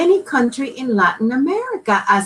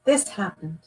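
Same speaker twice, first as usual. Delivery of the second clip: usual speaking voice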